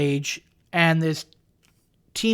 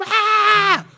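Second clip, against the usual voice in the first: sudden high shriek, imitating kids; a quick hammed-up scream; high mock scream; 12 semitones higher, 4 dB louder than usual. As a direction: shriek like a startled kid.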